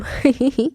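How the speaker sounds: short bright laugh; a quick amused laugh; playful chuckle. A narrator's reading. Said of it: "وہ زور سے ہنسا".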